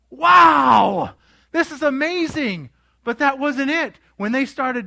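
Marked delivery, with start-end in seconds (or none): 0.1-2.7 big drawn-out wow, loud and high; awestruck shout. Retold or imitated. Disciples marveling at the new loaves, awestruck. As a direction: cry out in hammed-up amazement. Voices awestruck miracle witnesses.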